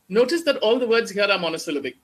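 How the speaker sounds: ordinary delivery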